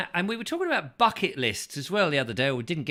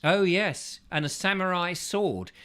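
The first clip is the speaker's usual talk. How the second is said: normal talking voice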